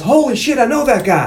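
high, acted exclamation of surprise; startled recognition. [name] spots someone he knows, mock startled outburst. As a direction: jump up in pitch as recognition hits.

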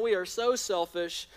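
normal talking voice

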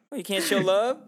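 high, loud teasing retort; playful mock indignation. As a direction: tease like a hurt friend. Playful mock movie quote.

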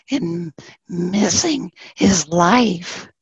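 ordinary delivery

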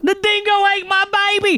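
high-pitched hammed-up Australian accent; exaggerated Australian wail; mock Australian. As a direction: squeal it like a bad Aussie impression.

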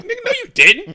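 high, loud, laughing mock indignation; cracking up while faking fury; mock outrage. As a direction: squeal it out in laughing disbelief. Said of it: "I was mad".

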